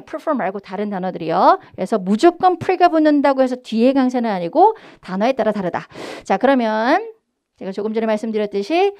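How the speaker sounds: ordinary talk, nothing marked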